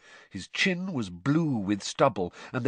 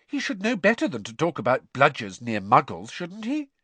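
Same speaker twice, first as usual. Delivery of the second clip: 1 Ginny, questioning; 5 semitones higher, 4 dB louder than usual. Acted.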